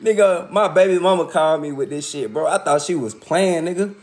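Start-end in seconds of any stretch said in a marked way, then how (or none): none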